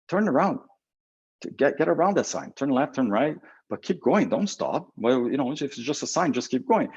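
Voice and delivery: high, emphatic coaching, pushing them on; rally a friend who wants to quit; urgent pep talk. Pretend. To a late friend on the phone, an urgent high pep talk.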